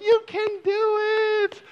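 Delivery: high, loud mock encouragement; cheering race worker. Race workers urging on a struggling runner, high loud cheer. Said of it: "And they're like".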